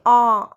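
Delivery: a long, loud, wide-open "आ" for the class; open vowel demo. Shown as a demonstration. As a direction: open the mouth fully on "आ" and hold it. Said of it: "जब हम आ बोलते हैं तो हमारा पूरा मुंह खुल रहा है".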